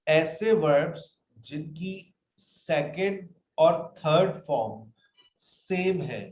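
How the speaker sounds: slow, word-by-word reading from the sheet; dictating slowly to students; slow dictation. Shown as a demonstration. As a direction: spell it out slowly for the class. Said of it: "अब वो कह रहा है".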